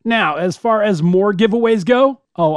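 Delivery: ordinary delivery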